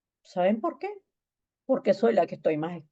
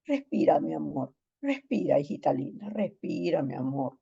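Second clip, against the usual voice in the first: soothing; 4 semitones lower, 4 dB quieter than usual. Retold or imitated. Pastor calming a crying girl.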